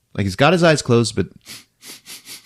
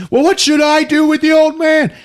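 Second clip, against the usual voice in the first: high, strained yelled question; mock shouting; 4 dB louder than usual. Character calls after the departing driver about the old man, mock-frantic shout. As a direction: yell it way up high, imitating a flustered character.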